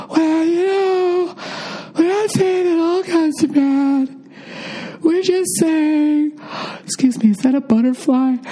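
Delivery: high, very slow whiny liberal voice. Pretend. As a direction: drag it out like a pleading lefty. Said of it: "the people on the left can be like".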